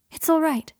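high, loud, happy call. A narrator's reading. Friend at the door to the man waiting, happy. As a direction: announce good news happily. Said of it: "she said happily".

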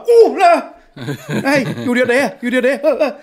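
loud, high, appalled disbelief; mock horror. Hungover man remembering what he did, horrified disbelief. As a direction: cry out in comic dismay. Reacting to embarrassing flashbacks.

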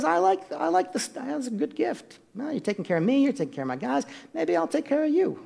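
high, soft wheedling of someone bought; imitated bribed king. King warming to those bribing him, mock pleased voice.

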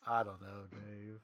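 low, soft, hesitant brush-off; mock-reluctant, trailing away quietly. Acted reluctant answer to the begging.

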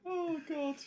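high, helpless laughter through the words; squeaky real laughter at his own joke; laughing. Real, not acted. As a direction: wheeze out the words while still laughing. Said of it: "You made yourself laugh there".

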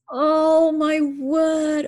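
high, drawn-out, slow gasp of amazement; awed surprise. Real, not acted. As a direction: let it float up slowly, genuinely moved. Hearing her favourite song named.

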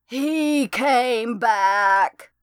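high, very slow, otherworldly drawl; ghost voice. A narrator's reading. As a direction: let each word hang like a dead woman's voice. Ghost voice answers.

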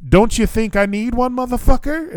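high-pitched incredulous sarcasm; with an attitude. Retold or imitated. Traveler asked if he wants another flight, sarcastic attitude. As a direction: re-enact indignation, rising high to the end. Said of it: "I still had an attitude".

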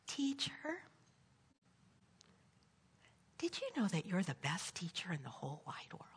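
put-on soft, sweet child's whisper; little girl whisper. Little girl whispering praise to her teacher. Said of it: "Little girl says".

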